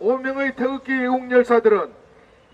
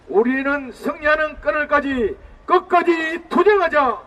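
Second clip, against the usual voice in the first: roaring rallying speech; 2 semitones higher than usual. Acted. Closing call to struggle.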